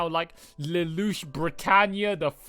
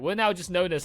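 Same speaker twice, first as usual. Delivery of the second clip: usual speaking voice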